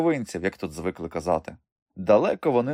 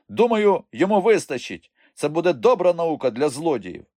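high, loud character voice; older trader's voice; 8 semitones higher, 4 dB louder than usual. A narrator's reading. Older trader to angry Stefan, firm verdict. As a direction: voice a trader calling it off, pitched up.

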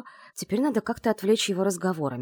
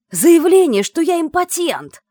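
furious roar, higher and louder; 11 semitones higher, 12 dB louder, slower than usual. Acted. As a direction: bellow it, slow and outraged. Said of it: "взревел Исаев".

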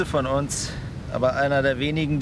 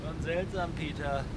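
hushed; 8 dB quieter than usual. Acted. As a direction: speak quietly, as if to yourself. Quiet talk about dying on island.

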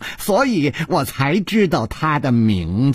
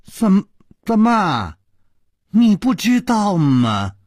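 stammering, raised and slow; surprised; 6 semitones higher, slower than usual. Acted. 楚留香 stammers in shock at 尹姬's words. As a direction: stumble over the words in shock. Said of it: "楚留香惊讶地说".

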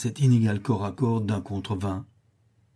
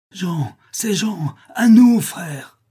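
high, slow, loud call to a brother; joyful shout; 8 semitones higher, 7 dB louder, much slower than usual. A narrator's reading. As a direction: call out to Jean across the fight. Rescuers recognize Jean's signal. (appel crié, lent et joyeux)

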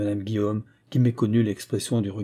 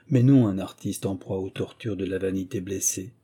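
ordinary delivery

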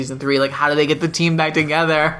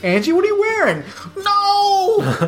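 mock high squeal; slower than usual. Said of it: "He's gonna wonder, like".

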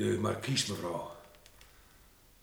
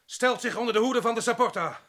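loud public declaration; 10 semitones higher, 4 dB louder than usual. Acted. André confesses to the whole party.

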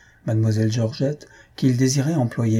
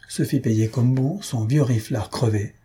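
ordinary delivery, nothing marked

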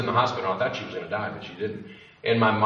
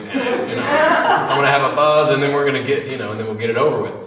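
laughing, louder, higher bursts; laughing buzz; 3 semitones higher, 9 dB louder than usual. Retold or imitated. Talking himself into taking the pills, laughing loud. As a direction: laugh through it, giddy at the thought of a buzz. Retelling inner voice before dosing.

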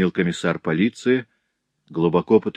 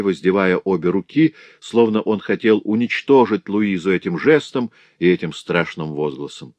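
plain reading voice

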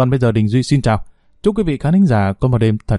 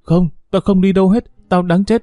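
raised, pleading lament; a girl's mild voiced despair; despairing plea; 7 semitones higher than usual. A narrator's reading. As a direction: cry out that she is lost. Hiền refusing in despair.

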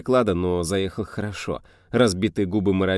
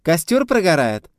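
high loud ringing shout; ringing call; 10 semitones higher, 8 dB louder than usual. A narrator's reading. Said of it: "звонко завелся голос Лизаветы".

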